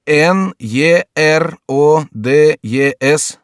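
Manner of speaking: spelling aloud. A narrator's reading. Courtier spelling out the surname, letter by letter. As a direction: call out each letter on its own.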